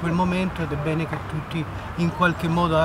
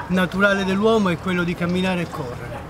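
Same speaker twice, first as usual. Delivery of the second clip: usual speaking voice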